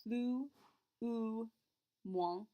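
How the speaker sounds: Plus ending on oo, s silent, then ou, moins; careful French. Shown as a demonstration. Teacher repeats the phrase for students, carefully. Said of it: "sounds exactly like that oo".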